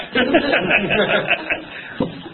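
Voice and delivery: real laugh after the joke; hearty laugh. Real, not acted. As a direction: burst out laughing.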